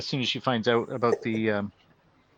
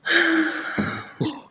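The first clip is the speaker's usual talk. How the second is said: high squealing laugh; 7 dB louder than usual. Real, not acted. Laughing at helium Vader.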